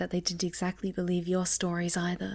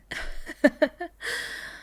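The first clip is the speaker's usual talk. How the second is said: airy teasing giggle, trailing up; playful breathy giggle; 5 semitones higher than usual. Acted. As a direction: giggle at your own mischief. Teasing after calling herself a monster.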